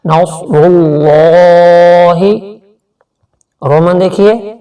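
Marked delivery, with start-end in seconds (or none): 0.0-2.2 slow chanted tajweed recitation; Quran recitation. Shown as a demonstration. Teacher demonstrating recitation to students, slow and chanted. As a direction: draw out each syllable in melodic recitation. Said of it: "ایک الف کے برابر کھینچیں گے".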